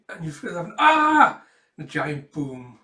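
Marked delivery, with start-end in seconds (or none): 0.8-1.4 scream. Acted. Blast hits the child.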